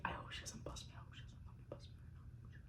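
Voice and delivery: a near-silent whisper of her own thoughts; panicked inner whisper. Retold or imitated. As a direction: whisper the worry under your breath. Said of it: "freaking out in my fucking head".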